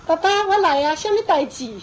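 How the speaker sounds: 轻快地学两三岁孩子抢着回应; 像乖巧小孩那样雀跃答话; 模仿幼儿应答. Retold or imitated. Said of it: "两三岁哦". (学小孩子兴冲冲地应声)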